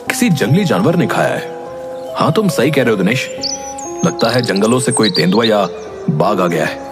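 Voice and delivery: usual speaking voice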